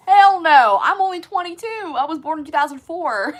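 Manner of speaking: high, loud disbelieving exclaim; excited outburst. Real, not acted. Answering the chat's tour question, excited and loud. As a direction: blurt it out, almost yelling. Asked if she saw his tours.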